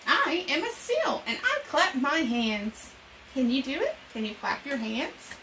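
put-on bright, lively read-aloud for young kids; storytime reading. Reading an animal picture book to children, bright storytime voice.

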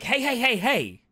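high, loud calls of hey; calling out to stop him, retold; hammed-up alarmed hey; mock shout. Friend calling out in retold scene.

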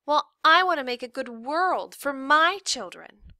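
put-on high, loud kid throwing words back; one-up mom in a child's voice; defiant girl, stressing I and MY; retorting daughter. A narrator's reading.

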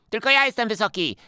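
high, loud boastful yell; shouting. Acted. Eusebiusz claims the boxes, shouting high and loud. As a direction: shout it, shoving the others off. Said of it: "krzyczał Eusebiusz".

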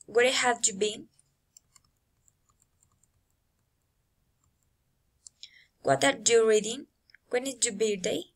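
"been", "reading", "birthday" lifted at the end; rising intonation. Shown as a demonstration. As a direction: let each question climb on "been", "reading", "birthday". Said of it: "rising intonation".

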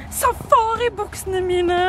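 high, slow presenting voice; showy announcement. Acted. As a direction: present the trousers like a catwalk host. Shows off her safari trousers. (høy og langsom presentasjon)

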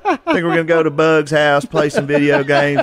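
hillbilly accent. Shown as a demonstration. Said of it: "a hillbilly accent".

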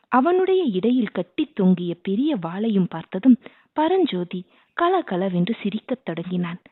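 usual speaking voice

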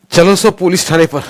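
high, loud rallying shout; loud call. Acted. A boy calling the crowd to march on the station, loud shout. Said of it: "मोठ्यानं ओरडला".

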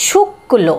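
শুক্ল with a held, forceful ক; stressed k sound. Shown as a demonstration. Teacher demonstrating the rule to learners, শুক্ল with stressed k. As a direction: double the k in শুক্ল before releasing the l. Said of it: "কয়ের উচ্চারণটা কিন্তু একটু জোর হচ্ছে".

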